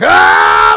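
loud Dragon Ball style power-up scream; powering-up yell. Shown as a demonstration.

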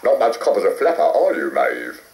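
lightly voiced RAF pilot; a hint of a brisk flight instructor. A narrator's reading.

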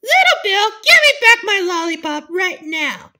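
high, loud demanding shouts; angry shouting. Pretend. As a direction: yell after the thief. Bully steals lollipop.

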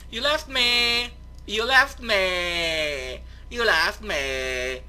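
slow, drawn-out mock lament; annoying YouTuber whine. Player to friend who moved off, hammed-up whine. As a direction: stretch every word out in hammed-up reproach. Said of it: "exhausting talking like this".

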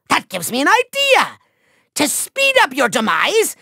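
ordinary talk

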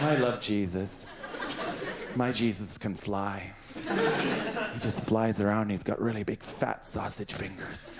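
slow doting voice gushing about Jesus; a touch of a doting simpleton. Acted. Childlike Jesus-lover persona.

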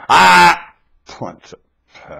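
a sharp Ah, then Punta Fe dropped slow and hushed; mimicked mood switch. Mimicking how the friend says it before a fight, mock menace. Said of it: "You go".

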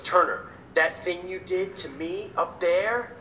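slow, measured mock confrontation; imitated solemn mom. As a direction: build it slowly like a grave reproach, then deliver the punchline. Imitates mom confronting him after show.

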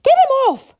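high, loud order; alarmed shout. Acted. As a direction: shout for help, beard under attack. Dragon attacks father's beard.

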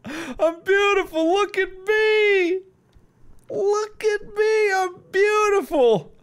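slow, high, preening self-admirer; high, drawn-out vain voice. Pretend. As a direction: coo at your own reflection. Admiring himself on TV.

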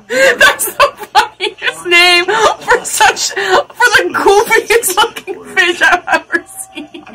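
high-pitched, slow words through real laughter; laughing. Real, not acted. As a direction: squeal it out while cracking up. Laughing hard at goofy fish.

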